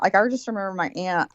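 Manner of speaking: ordinary talk, nothing marked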